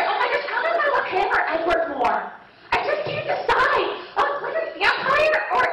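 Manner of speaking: high mock squeal, hammed up; swooning fangirl. Girl swoons over James Bond at preview, swooning.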